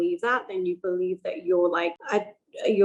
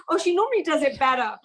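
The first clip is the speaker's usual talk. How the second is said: higher-pitched imitation of embarrassed parents; parents' voice, pitched up; apologetic parents; 6 semitones higher than usual. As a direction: lightly mimic parents excusing their child. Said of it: "they'd be like".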